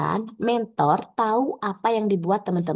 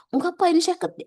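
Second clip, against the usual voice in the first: much higher-pitched mock objection; acted defensive protest; 11 semitones higher, faster than usual. Trainee arguing with examiner over typos, mock protest. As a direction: butt in with a mock excuse.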